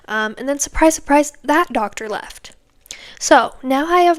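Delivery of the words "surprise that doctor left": hushed whispered aside; conspiratorial whisper of bad news; mock whisper. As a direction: whisper it like a dramatic secret. Repeats surprise, then whispers it.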